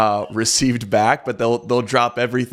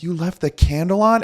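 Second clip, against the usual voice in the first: retold surprise; 6 semitones higher than usual. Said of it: "and I'm like".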